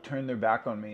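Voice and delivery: normal talking voice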